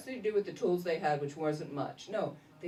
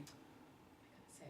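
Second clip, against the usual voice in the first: breathy whisper to herself; sneaking upstairs, whispering to herself; 24 dB quieter than usual. Retold or imitated. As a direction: whisper it so he won't hear. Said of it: "snuck upstairs quietly".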